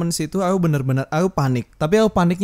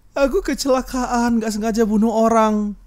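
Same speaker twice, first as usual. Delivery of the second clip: high, slow, whimpering fake sob; mock crying caller; 6 semitones higher, 4 dB louder than usual. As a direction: imitate the scammer's tearful plea.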